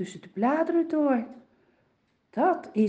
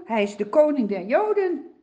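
usual speaking voice

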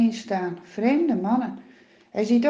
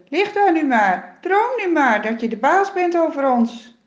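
higher, louder scornful taunt; 5 semitones higher, 6 dB louder than usual. Acted. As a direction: sneer down at him in the pit. Cruel brothers taunting Jozef.

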